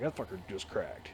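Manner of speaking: half to himself, dreading the ball broke; hushed inner panic. Retold or imitated. Telling a friend his fear the ball cracked, murmured low.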